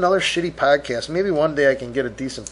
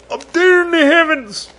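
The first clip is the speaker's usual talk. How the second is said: high, louder hammed-up wail; mock emotional wail; 4 dB louder than usual. Host to late parents in the heavens, mock emotional wail. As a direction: cry it out to the heavens, overdone. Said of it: "I'm gonna get emotional".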